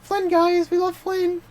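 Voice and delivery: squeaky-high playful falsetto; high-pitched mock cheer. Fan joking to friends about loving Flynn, high mock cheer.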